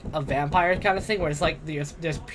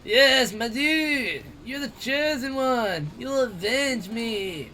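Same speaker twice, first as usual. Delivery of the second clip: higher, slow, solemn mentor voice; inspiring mentor voice; 4 semitones higher, much slower than usual. Acted. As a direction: speak like a wise mentor passing on a quest. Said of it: "it wasn't the stoner voice this time".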